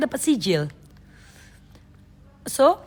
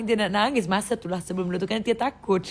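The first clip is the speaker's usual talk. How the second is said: ordinary talk, nothing marked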